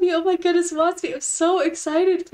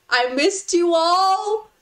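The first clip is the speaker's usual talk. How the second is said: ordinary talk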